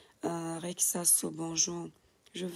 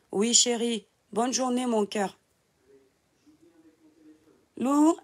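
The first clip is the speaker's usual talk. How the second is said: loud, much higher call to someone in another room; raised, high-pitched loving call carried over a distance; calling out to husband; 7 semitones higher, 8 dB louder than usual. Real, not acted. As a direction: shout your goodbyes across the house to your husband. Calling to husband leaving for work.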